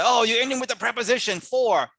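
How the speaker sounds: mock pedant. Teacher mocking pedants who object to a final "for". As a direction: play a nitpicking grammar scold. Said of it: "And people will say".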